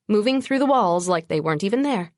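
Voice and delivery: plain reading voice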